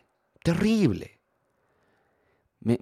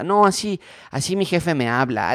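higher, louder defensive protest; 6 semitones higher, 3 dB louder than usual. Retold or imitated. Spouse defending flirty messages, protesting loudly. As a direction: brush it off insistently.

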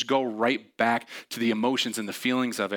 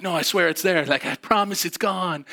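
pleading; 7 semitones higher, 4 dB louder than usual. Retold or imitated. Arguing with his own brain.

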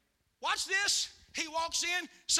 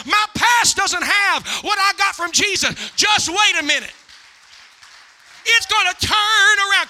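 loud, high, shouted exhortation; full-force preaching; 5 semitones higher, 13 dB louder than usual. Real, not acted. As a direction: belt it out to rouse the congregation.

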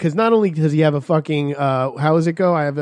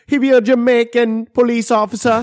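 higher, put-on Jamaican lilt; mock Jamaican accent; 7 semitones higher, 4 dB louder than usual. Friend explaining the film, mock Jamaican accent.

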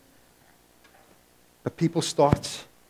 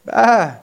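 mock dismayed ah; 7 semitones higher, 7 dB louder than usual. Reaction to grim talk.

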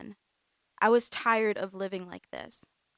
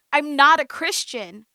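loud, higher, defiant cry; defiant outburst; 6 semitones higher, 13 dB louder than usual. A narrator's reading. Amanda shouts at her aunt and cousin, defiant. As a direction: blurt it out, defiant.

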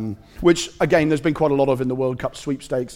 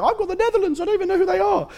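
put-on much higher, childlike nephew voice; young nephew; faster than usual. Nephew brags to uncle about his sweepstake team, in a high child voice. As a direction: squeak it out like an excited eight-year-old. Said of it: "and then he was just like".

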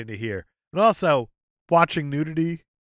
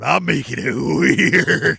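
loud, drawn-out creepy brag; creepy mock past self; 7 dB louder, much slower than usual. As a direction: stretch it out loud and weird, half laughing. Acting out younger self at movie.

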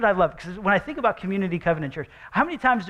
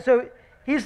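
ordinary talk

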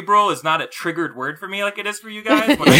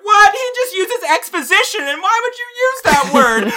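high, loud scoffing voice; mocking outraged critic; 5 dB louder than usual. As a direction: sneer it like an offended film snob. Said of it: "and people are like".

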